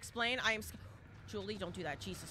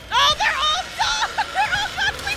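very high, loud, slow shriek; laughing high shriek; 14 dB louder than usual. Real, not acted. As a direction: scream it out in disbelief. Shrieking at the killers.

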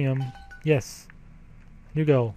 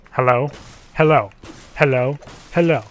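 loud repeated hellos; impatient calling; 5 dB louder than usual. Real, not acted. Player at a door that will not open, impatient calling. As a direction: call out at something that won't respond.

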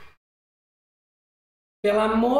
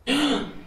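a sudden shocked intake of breath; startled gasp; sharp gasp; 6 semitones higher, 3 dB quieter than usual. Real, not acted. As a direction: gasp in real surprise. Shocked reaction to pregnancy reveal.

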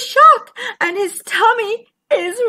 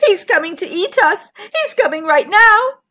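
high-pitched frantic fish; afraid; 9 semitones higher, 8 dB louder than usual. A narrator's reading. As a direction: plead for help in rising alarm. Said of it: "We're afraid of the shark".

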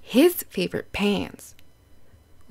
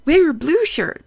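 a hint of a big brother, louder and higher; brother's voice; 6 semitones higher, 5 dB louder than usual. Brother Bob urging Pete to wear blue, lightly voiced and louder. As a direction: call it out like an eager brother.

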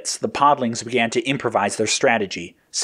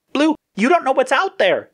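very high, anxious character voice; high-pitched concern; worried warning. A narrator's reading. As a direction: warn him in a high sidekick voice. Yellow warns Blue mid-battle.

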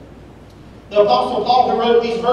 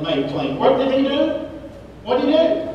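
usual speaking voice

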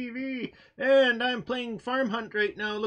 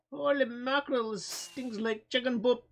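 normal talking voice

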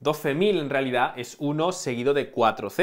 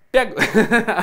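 laugh; 7 semitones higher, 7 dB louder than usual. Real, not acted. Laughs at own spit.

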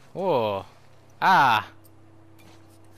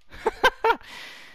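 high unvoiced laugh. Real, not acted. Laughs at own lament.

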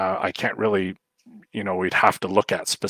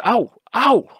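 high, loud "ow" yelps; sharp pretend cry of pain, pitched way up; mock yelp; 8 dB louder than usual. Shown as a demonstration. Trainer showing owners how to react to puppy biting, mock yelp.

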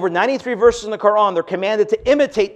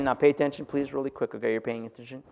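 dropped low and much softer to draw them in; hushed aside; 5 semitones lower, 11 dB quieter than usual. Real, not acted. Preacher hushing the crowd to pay attention. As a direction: lean in and hush it.

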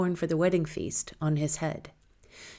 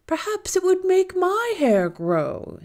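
put-on much higher, slow, old woman's voice; eager old woman; 14 semitones higher, 5 dB louder, slower than usual. A narrator's reading. Old mother eagerly asking the girl about hair growth, high and slow. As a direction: ask eagerly like an old crone. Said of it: "said the old woman eagerly".

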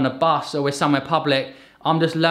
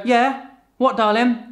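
put-on higher-pitched answer to a child; acted parent's reply; 8 semitones higher, slower than usual. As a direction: respond to the calling toddler, pitched up. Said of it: "and I go".

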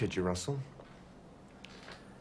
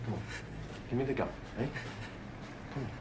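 soft, low, patient persuasion; coaxing; 3 semitones lower, 7 dB quieter than usual. Acted. Policeman coaxes Russell to hand over the gun, soft. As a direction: talk him down gently.

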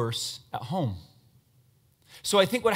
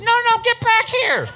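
much higher, louder, slow call after someone; 8 dB louder than usual. Real, not acted. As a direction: shout to stop her leaving. Calling volunteer back.

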